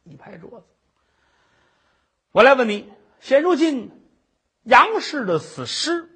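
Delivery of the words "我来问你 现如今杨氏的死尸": 威严审问; stern magistrate. Acted. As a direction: lay down the charge from the bench. Magistrate interrogates at court.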